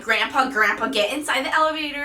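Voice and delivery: loud retold freak-out at grandpa. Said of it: "raising my voice".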